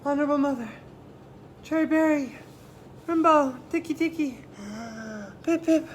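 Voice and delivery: high, loud, broken gasping phrases; out of breath. Acted. Chang, worn out, gasps to his mother in pieces. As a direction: gasp the name out in broken bits. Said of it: "So he's so tired".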